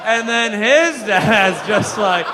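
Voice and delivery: "his" stretched long with a swooping rise; drawn-out stress on HIS. Real, not acted. Comic going back another generation of dads, "his" loudly stretched. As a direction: lean hard and loud on "his", dragging it out. Running bit, escalating lead-in.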